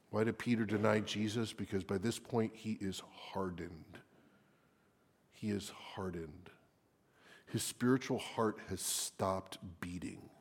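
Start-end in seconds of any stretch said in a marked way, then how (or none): 1.5-10.3 grave hushed whisper. Real, not acted. Preacher on Peter's hardened heart, whispered. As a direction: whisper it with weight.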